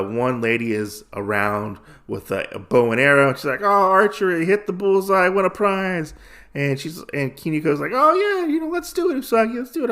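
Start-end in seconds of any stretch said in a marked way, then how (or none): 3.6-6.1 higher, punchy game-stall pitch; carnival barker. Retold or imitated. Carnival lady touting her game. 7.9-9.9 high-pitched, excited mock girl; eager urging. Eager character urging Usagi on.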